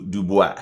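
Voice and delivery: "Dubois" said as doo-bwah, French style. Shown as a demonstration. Comic renaming Dubai, saying "Dubois" the French way. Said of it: "I like to call it Dubois".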